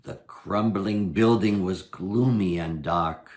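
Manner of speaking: plain reading voice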